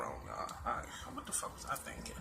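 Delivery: whispered aside. Real, not acted.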